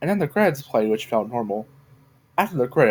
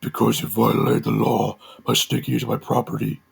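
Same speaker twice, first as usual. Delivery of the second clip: demonic voice. A narrator's reading. Masked figure threatening Joe in his shed, demonic voice. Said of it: "the person in the suicide bomber outfit responded".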